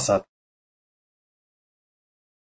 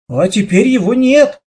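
plain reading voice

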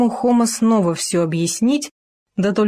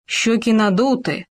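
ordinary delivery

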